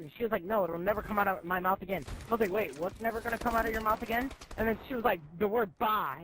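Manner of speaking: ordinary delivery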